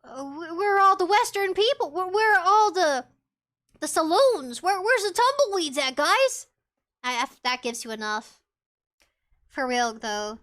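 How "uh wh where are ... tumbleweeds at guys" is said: higher, loud mock bewilderment; imitated baffled newcomer. Mimicking visitors who expect a Western, mock bewildered. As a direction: play a puzzled visitor, hammed up. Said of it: "You come here and you're like".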